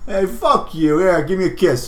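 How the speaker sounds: a hint of the silly Skid voice; lightly goofy Skid. Skid teasing the player, lightly squeaky.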